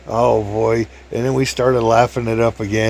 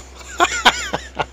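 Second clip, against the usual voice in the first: squealing laugh; real high laugh; high squeal of laughter. Real, not acted. Laughs retelling.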